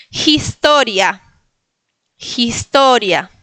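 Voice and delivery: "historia" slowly, h like j, stress on TO; second-syllable stress. Shown as a demonstration. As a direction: model "historia" as jis-TÓ-ria. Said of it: "segunda sílaba". ("historia" en polaco, con jota inicial)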